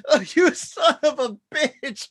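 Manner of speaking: a hint of a hammed-up sob, slow; half-hearted mock tears; mock crying. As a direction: whimper it like a fake crybaby. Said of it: "I just start crying".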